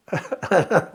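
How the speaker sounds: short soft laugh; a small pained laugh at yourself; rueful laugh. Real, not acted. Recalling telling the officer, a soft rueful laugh.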